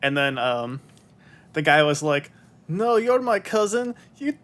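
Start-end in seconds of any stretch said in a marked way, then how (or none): 2.7-4.4 put-on Swedish accent. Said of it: "thick Swedish accents".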